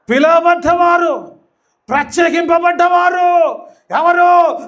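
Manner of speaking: full-force pulpit shout; fiery preaching. Acted. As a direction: preach it hard at the congregation.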